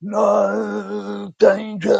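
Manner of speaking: hammed-up heroic shout; heroic warning. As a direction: call the alarm like an action hero, hammed up. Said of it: "I envisaged myself going".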